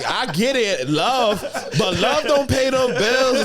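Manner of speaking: high and slow, laughing through it. Retold or imitated. Retelling advice to his girlfriend, laughing high and slow. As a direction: tease her while cracking up.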